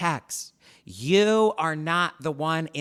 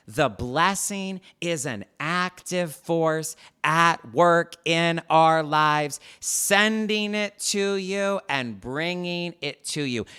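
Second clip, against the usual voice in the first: slow dictation; slower than usual. Shown as a demonstration. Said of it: "undeniable truth number two".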